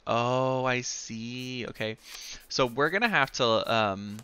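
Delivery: ordinary talk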